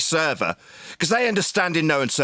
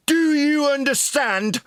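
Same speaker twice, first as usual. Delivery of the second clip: high, slow, scolding question; angry demand; 9 semitones higher, slower than usual. Real, not acted. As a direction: drive the question home, pitched up and drawn out. Berating an opponent in argument.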